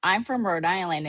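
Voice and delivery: normal talking voice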